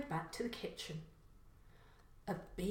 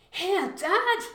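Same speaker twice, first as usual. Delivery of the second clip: 1 excited find; 12 semitones higher, 11 dB louder than usual. Acted. Son finds car key.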